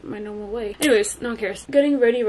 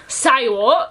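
put-on Australian accent; 7 dB louder than usual. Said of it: "this Australian accent".

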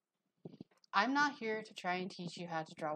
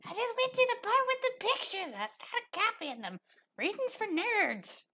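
high, scoffing student; dismissive student voice; 6 dB louder than usual. Retold or imitated. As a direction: brush off the reading like a brat. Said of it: "They're like".

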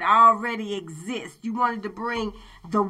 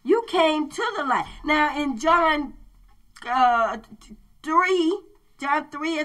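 preaching; 4 semitones higher than usual. Acted. Preacher returns to full force.

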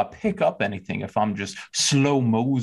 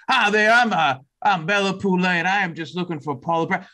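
higher, louder Louisiana drawl greeting; 7 semitones higher, 3 dB louder than usual. Acted. Bella introduces herself to the office worker in Louisiana drawl. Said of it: "my Louisiana draw".

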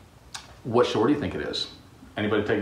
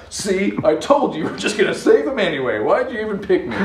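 pouting Jonah; 7 semitones higher, 3 dB louder than usual. Acted. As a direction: complain like a kid who got proven right. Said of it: "pouts like".

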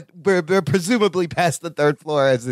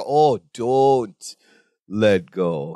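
deep mock dread; 10 semitones lower, much slower than usual. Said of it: "I was like".

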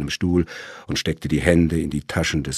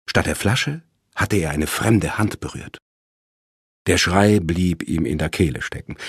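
ordinary delivery, nothing marked

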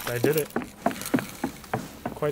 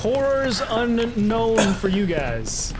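slow, raised, drawn-out proclamation; mock-grand threat; 4 semitones higher, 4 dB louder, much slower than usual. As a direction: stretch the words out like a villain.

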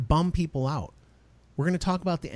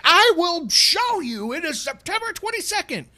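triumphant husband; 13 semitones higher, 4 dB louder than usual. Retold or imitated. Husband proving his wife wrong, high and gloating. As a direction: lord it over her. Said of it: "you're like".

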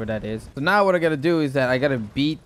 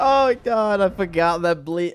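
dismayed surprise; 5 semitones higher, 4 dB louder than usual. Real, not acted. Realises his own mistake.

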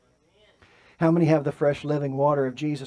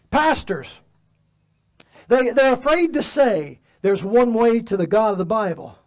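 high, loud fiery sermon delivery; preaching at full force; 8 semitones higher, 6 dB louder than usual. Acted. Pastor fires up the congregation, preaching loud and high. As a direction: thunder it from the pulpit.

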